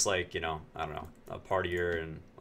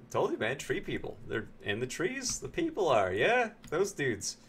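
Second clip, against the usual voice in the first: mock spooky agreement; 7 semitones higher than usual. Mock-confirming tree people to Brad.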